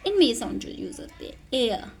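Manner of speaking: ordinary talk, nothing marked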